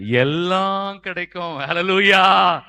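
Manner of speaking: drawn-out build to a loud Hallelujah; slow, swelling call to the congregation; rousing preaching. Real, not acted. (எழுச்சியூட்டும் பிரசங்கக் குரல்)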